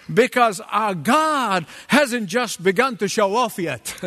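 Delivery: ordinary talk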